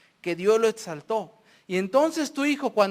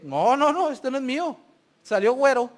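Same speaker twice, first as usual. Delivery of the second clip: mock disowning father; 3 semitones higher, 6 dB louder than usual. Acts father disowning a child.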